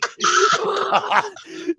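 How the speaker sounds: loud high laugh; shrieking laugh. Real, not acted. Big laugh.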